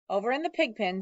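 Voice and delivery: ordinary delivery, nothing marked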